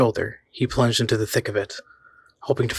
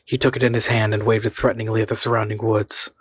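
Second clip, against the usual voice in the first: usual speaking voice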